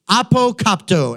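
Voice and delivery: apokopto said clearly as ah-poh-KOP-toh; Greek word apokopto. Shown as a demonstration. Preacher teaching the congregation a Greek word, careful pronunciation. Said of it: "The word he uses here in Greek".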